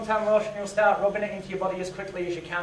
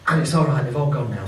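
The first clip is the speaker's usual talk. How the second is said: usual speaking voice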